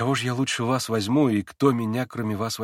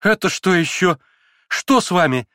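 higher and louder sudden outcry; girl startled by a kiss; startled cry; 8 semitones higher, 7 dB louder than usual. Acted. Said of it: "вскрикнула Лиз".

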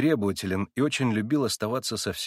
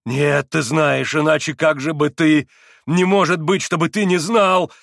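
furious insistence; 6 semitones higher, 9 dB louder than usual. Acted.

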